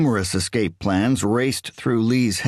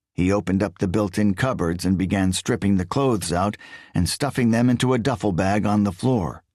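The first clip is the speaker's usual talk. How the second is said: usual speaking voice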